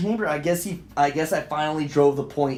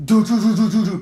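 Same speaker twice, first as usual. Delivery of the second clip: loud, high "do-do-do" banging; hard, rapid vocal knocks; imitated pounding; 5 semitones higher, 8 dB louder than usual. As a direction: mimic a fist hammering the door. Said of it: "pounding on my door".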